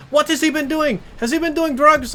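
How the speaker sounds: high, loud imitation of an angry Indian mother; Indian-accented screaming. Angry mother confronts her son's friend, shrill mock Indian accent. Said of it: "she's, like, screaming mad at me".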